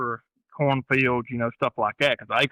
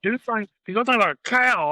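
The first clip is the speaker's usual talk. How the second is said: lightly voiced amazed cousin, pitched up; high mock cousin voice; 11 semitones higher, 4 dB louder than usual. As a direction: play the cousin marvelling at a huge deer. Cousin voiced in retold story.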